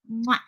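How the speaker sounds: smacked "mwah" kiss; affectionate kissing sound; kiss noise. Real, not acted. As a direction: blow an audible kiss to a friend. Blows a kiss to a viewer.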